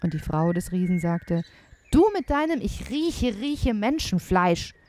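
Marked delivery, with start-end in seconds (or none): 1.9-4.8 exasperated wife mimicking her husband; mocking wife. A narrator's reading. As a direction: throw his phrase back at him mockingly. Wife mocks the giant's phrase.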